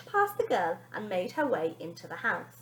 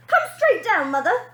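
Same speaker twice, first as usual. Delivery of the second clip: high, loud bossy command; ordering; 10 semitones higher, 11 dB louder than usual. A narrator's reading. Girl ordering her mother downstairs, high and loud. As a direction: boss her mother about. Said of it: "demanded the girl".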